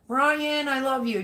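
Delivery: gushing. Real, not acted. Gushing at a chat viewer.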